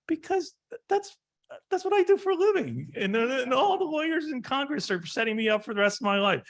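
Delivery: squeaky high, loud, laughing through it; mock exasperated retort. Lawyer retelling his joking answer to clients, high-pitched and laughing. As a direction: play up the outrage, half laughing.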